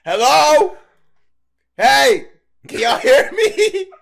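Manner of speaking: high, loud call, slow at the end. Retold or imitated. Calling out loud to residents in the dark unit. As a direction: shout into a dark apartment. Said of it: "I'm screaming out loud".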